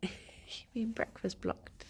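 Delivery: normal talking voice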